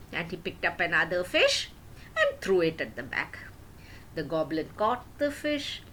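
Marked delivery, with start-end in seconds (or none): none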